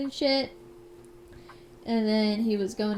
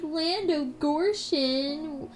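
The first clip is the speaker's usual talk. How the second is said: higher, drawn-out hammed-up hunter; put-on indignant hunter, raised pitch; snapping hunter; 5 semitones higher, slower than usual. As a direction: draw out the mock scolding, pitched up. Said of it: "he's like, ... He snapped".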